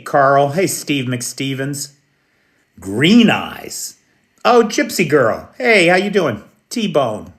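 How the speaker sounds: ordinary talk, nothing marked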